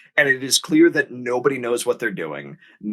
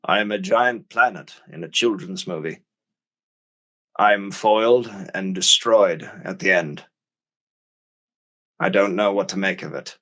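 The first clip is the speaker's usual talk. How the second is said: lower, weary Orson Welles voice; Orson Welles impression; 4 semitones lower than usual. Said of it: "and he's like".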